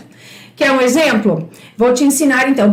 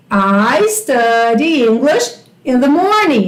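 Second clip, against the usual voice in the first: slow teacher demo; 3 semitones lower, slower than usual. Shown as a demonstration. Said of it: "Eu estudo inglês de manhã".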